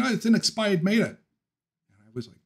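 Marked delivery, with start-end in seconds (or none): 0.0-1.2 put-on Boston accent. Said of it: "And he kept saying".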